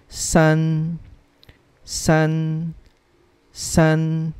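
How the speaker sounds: San held high and level; first tone. Shown as a demonstration. Teacher reading number three to learners, San in high level first tone. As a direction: say San as a long, steady high note. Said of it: "first tone".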